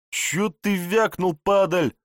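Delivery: high, slow, sneering thug. A narrator's reading. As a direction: spit it out like a street thug. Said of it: "Голос бандита изменился".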